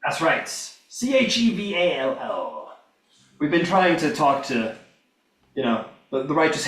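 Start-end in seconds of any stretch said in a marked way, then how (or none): 0.5-2.8 slow, letter-by-letter spelling; measured pace, each letter separate. Real, not acted. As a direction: spell it out deliberately. Spells out the title.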